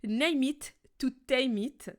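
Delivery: slow English phrase with a French accent. Shown as a demonstration. Coach tells listeners not to judge, slow French-accented English. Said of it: "alors juge pas mon accent".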